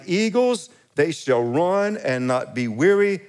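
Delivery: lifted pulpit proclamation; fervent scripture reading. Acted. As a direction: proclaim the promise.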